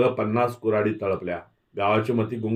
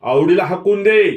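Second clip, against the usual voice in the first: high, loud yelled demand; shouting crowd; 8 semitones higher, 8 dB louder than usual. A narrator's reading. Whole village shouting at Dhanaji to expel Avdi, loud high yell. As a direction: yell it like an angry mob. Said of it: "गाव पुन्हा खोडून ओरडू लागला".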